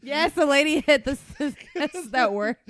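ordinary talk

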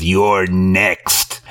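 low, drawn-out threat; menacing grandpa. Retold or imitated. Retelling the flashback of grandpa with the axe, low menacing voice. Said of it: "and the grandpa says".

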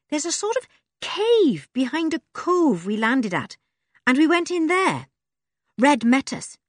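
put-on a scruffy girl who trusts nobody; Joe, urchin girl. A narrator's reading.

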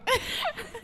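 high breathy squeal laugh. Real, not acted.

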